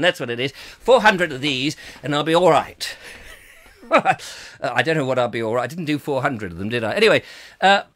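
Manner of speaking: ordinary talk, nothing marked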